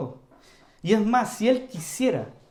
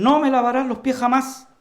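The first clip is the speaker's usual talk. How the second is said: Peter's loud, higher protest; raised voice; 6 semitones higher, 6 dB louder than usual. Retold or imitated. Peter raising his voice at the Lord, loud. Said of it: "Él le alza la voz al Señor".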